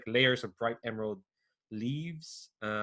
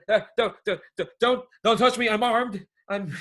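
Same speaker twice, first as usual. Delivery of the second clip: panicked; 10 semitones higher, 8 dB louder than usual. Acted. Startled youth threatens the party.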